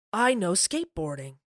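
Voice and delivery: lightly voiced deadpan movie hero; a hint of a bad Keanu impression; Keanu Reeves impression. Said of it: "In a terrible Keanu Reeves impersonation".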